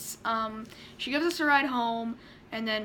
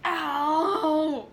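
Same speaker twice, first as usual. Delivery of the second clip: long drawn-out cry of pain; 3 semitones higher, 4 dB louder than usual. Real, not acted. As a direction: let out a real ow at back pain. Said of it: "sorry, my back hurts".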